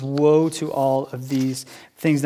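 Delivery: slow, grave woe; solemn pronouncement. Acted. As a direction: pronounce it like a verdict. Quoting Jesus' woe.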